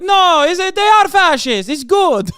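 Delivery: high, loud hammed-up Italian; mock Italian accent. Italians answering about fascism: high, loud mock Italian accent. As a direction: proudly blurt it out in a cartoon Italian voice.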